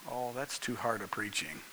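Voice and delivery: very soft, low aside; hushed, low mock objection; muttered complaint. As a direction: grumble it under your breath. Voicing a listener's complaint in aside.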